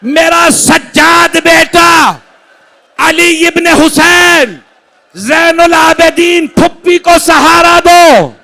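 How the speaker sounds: loud, high, slow lamenting cry; father's slow loud lament to his son; mourning call. Acted. Husain calling his son in mourning.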